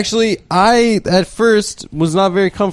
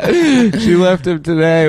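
laughing; 4 semitones higher, much slower than usual. Real, not acted. Gleeful, slow, laughing.

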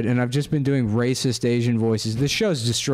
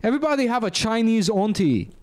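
Chinese auntie accent; 10 semitones higher than usual. Shown as a demonstration. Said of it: "who talk like this".

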